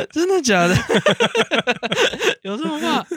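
high-pitched laughing tease; laughing disbelief. Real, not acted. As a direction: ask it while giggling, half in disbelief. Teasing through laughter.

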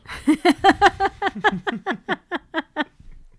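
rolling burst of laughter; long laugh. Real, not acted. Laughs at made out joke.

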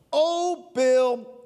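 high loud cry to Baal; shouting prayer. Acted. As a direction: call out desperately to the idol.

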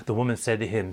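ordinary talk

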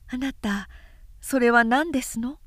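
a hint of a childlike new wife; innocent young bride. A narrator's reading. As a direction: ask sweetly like a naive young bride. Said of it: "あどけない新妻は".

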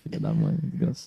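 unvoiced, under-the-breath swearing; soft mock cursing under the breath; imitated muttered cursing. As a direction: whisper the insult as if to yourself. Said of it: "Fica assim".

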